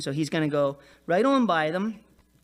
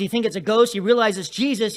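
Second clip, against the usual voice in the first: ordinary talk, nothing marked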